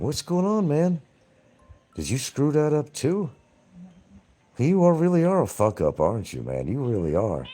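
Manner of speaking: mocking taunt. Real, not acted. Taunting rival on stream.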